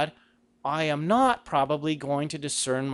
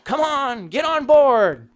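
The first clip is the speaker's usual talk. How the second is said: high, loud, slow coaxing; coaxing plea; 9 semitones higher, 7 dB louder, slower than usual. Pretend. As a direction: wheedle God to get on board. Coaxing God to buy in.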